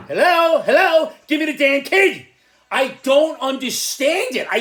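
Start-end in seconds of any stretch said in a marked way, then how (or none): 0.1-2.3 mock CPS demand. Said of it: "C P S should be like".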